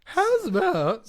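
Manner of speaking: very high, slow, loud hammed drawl; mock Southern. Shown as a demonstration. Stan coaches the Southern line, high and slow. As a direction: stretch it out like a game-show host.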